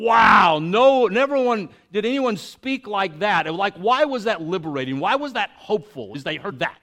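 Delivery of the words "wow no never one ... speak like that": loud, high astonished exclaim; amazed crowd. Retold or imitated. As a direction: marvel out loud like the awed crowd. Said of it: "the throngs kept coming and going".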